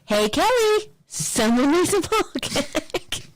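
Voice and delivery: high, slow singsong call; teasing call-out. Pretend. Calling to Kelly as a joke, high singsong. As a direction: sing it out high, drawing out the end.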